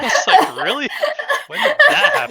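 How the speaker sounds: high, loud, laughing disbelief. Real, not acted. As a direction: crack up while teasing a friend. Joking about the sarcasm, amid laughter.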